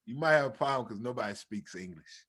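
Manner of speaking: quiet, low voice imitating a kid; understated tip-off to the coach; low-key aside. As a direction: let it sink low and soft. Kid in back retold again.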